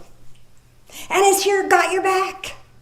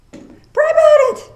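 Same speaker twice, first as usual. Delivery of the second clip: much higher and louder cry; fervent exhortation; 8 dB louder than usual. Acted. Preacher urging the congregation to pray, crying out high. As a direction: call it out like a revival preacher.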